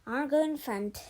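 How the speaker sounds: bossy announcement. A narrator's reading. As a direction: claim the front seat loudly. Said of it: "Sage said to Georgie".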